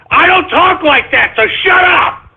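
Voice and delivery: high-pitched, exasperated retort; indignant protest. Real, not acted. Host denying he talks like that, indignant protest. Host rejects caller's assumption.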